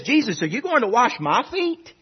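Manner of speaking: mock-astonished question with a hint of laughter; incredulous Peter. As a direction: play a baffled disciple questioning his master. Acts Peter questioning Jesus.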